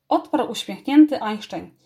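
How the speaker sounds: ordinary delivery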